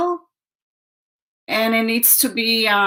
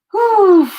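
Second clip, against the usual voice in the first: breathless whew; 8 semitones higher, 7 dB louder than usual. Real, not acted. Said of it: "I need to take a breath".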